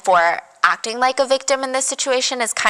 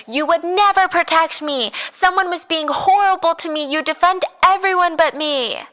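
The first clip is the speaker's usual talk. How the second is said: high-pitched retold outburst, no real volume; mock screaming; 7 semitones higher than usual. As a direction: imitate screaming at him, pitched way up. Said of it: "she starts full on screaming at him".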